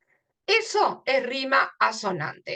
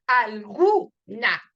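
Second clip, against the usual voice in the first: "alguna" slowly, GU punched, "na" detached; stressed syllable; 4 dB louder, much slower than usual. Shown as a demonstration. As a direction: hit the U in "alguna" and pause. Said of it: "el fonema que se acentúa".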